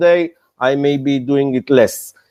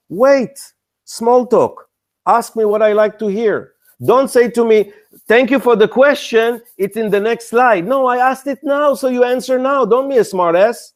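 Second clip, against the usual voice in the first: higher, pointed exasperation at a presenter; angry investor rant; 5 semitones higher than usual. Retold or imitated. Investor scolding an evasive startup founder, indignant and higher. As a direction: scold the startup like you're fed up. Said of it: "I'm getting angry just by telling it".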